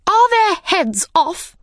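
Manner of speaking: very high, demanding shouted question; Queen's shout. A narrator's reading. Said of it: "shouted the Queen".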